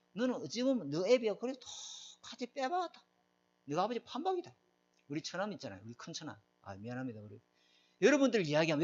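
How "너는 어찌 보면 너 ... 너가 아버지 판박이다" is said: relatives voiced. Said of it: "동네 사람들이, 사촌 일가들이". (친척 어른들 흉내, 살짝 느리게)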